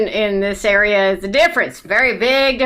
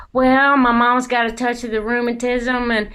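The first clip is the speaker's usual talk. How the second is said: chatty Southern cashier; 2 semitones higher than usual. Retold or imitated. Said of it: "the cashier's like".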